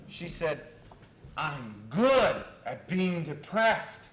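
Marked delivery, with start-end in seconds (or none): 1.4-4.0 slow, loud, building acted wail; exasperated protest. Client protesting her lost depression, slow exasperated shout. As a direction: build from slow emphasis to a high, loud complaint.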